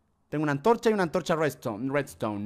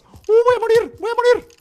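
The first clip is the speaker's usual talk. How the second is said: panicked shout; 7 dB louder, slower than usual. Real, not acted. Poisoned in fight.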